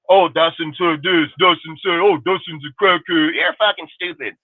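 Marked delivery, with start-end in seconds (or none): 0.1-3.3 mocking critics. Streamer mimics rival channel gossiping about him, mocking. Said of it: "some fake ass bullshit".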